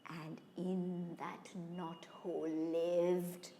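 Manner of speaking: low, hushed narration with long pauses; ominous storytelling. A narrator's reading. Storyteller revealing the hidden snake, low ominous hush. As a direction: drop low and soft, drawing out the menace.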